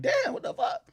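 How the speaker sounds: stunned. Retold or imitated. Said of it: "I'm like".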